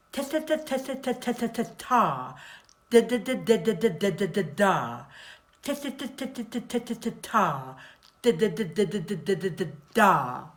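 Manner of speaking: crisp "ta" and "da" struck with the tip of the tongue; t and d drill. Shown as a demonstration. Voice coach drilling the tip of the tongue with ta and da. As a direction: tap out the syllables on the tongue tip. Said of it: "the t sound".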